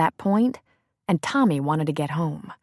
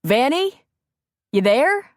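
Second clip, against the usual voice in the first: calling out; 7 semitones higher, 4 dB louder, slower than usual. A narrator's reading. Matt calls for his wife.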